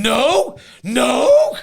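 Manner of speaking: high, loud, stretched protest; appalled mock cry. Mum stuck standing there at the market, appalled mock cry. Acting appalled bystander in anecdote.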